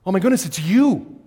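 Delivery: loud, high astonished cry; stunned to see a friend; surprised recognition. Pretend. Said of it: "He doesn't say".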